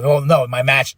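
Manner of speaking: ordinary talk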